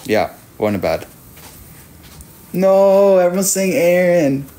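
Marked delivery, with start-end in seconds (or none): 2.5-4.4 loud protest. Real, not acted. Chat keeps naming Aaron.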